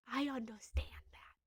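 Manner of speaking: whispered, gentle and close. Real, not acted. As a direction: breathe it like a comfort. Gentle reassurance to grieving Sue.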